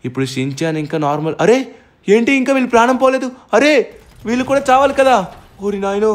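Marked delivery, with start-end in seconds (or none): 1.5-6.1 disbelief. Real, not acted. Enemies still alive.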